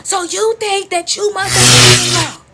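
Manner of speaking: loud, high, drawn-out angry voice; acted furious mother; mama bursting back in. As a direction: burst in hollering an accusation. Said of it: "here the door come again".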